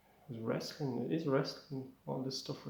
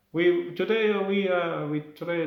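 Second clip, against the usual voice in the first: put-on louder, raised Italian-accented start; 5 semitones higher, 7 dB louder than usual. Roman teacher begins the class, Italian accent. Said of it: "I'll do the Italian accent".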